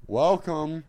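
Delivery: loud, high talk-show host greeting; Seth Meyers. Acted. Trying a requested celebrity impression, loud high host voice. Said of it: "Uh, Seth Meyers".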